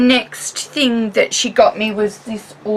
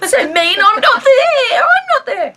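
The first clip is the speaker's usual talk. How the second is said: much higher teasing whine at friends on Skype; mock whine; 3 dB louder than usual. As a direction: pout it out like a left-out kid. Left out of friends' cuddle.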